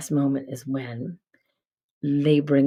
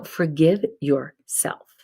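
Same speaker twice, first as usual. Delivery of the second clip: usual speaking voice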